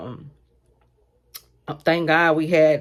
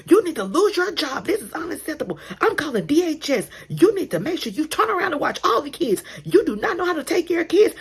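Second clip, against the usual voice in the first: angry mom yelling; 11 semitones higher than usual. Retold or imitated. Mom yelling at Sally to lose her job. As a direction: berate her like a furious parent.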